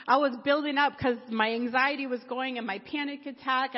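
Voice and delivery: ordinary delivery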